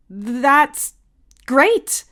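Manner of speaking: sarcastic. Acted.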